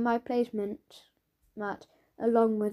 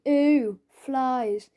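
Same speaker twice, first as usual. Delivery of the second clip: teasing; 5 dB louder than usual. Acted. Tom teasing Marcus on the way out, loud mock surprise. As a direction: tease him as you walk past. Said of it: "So I say".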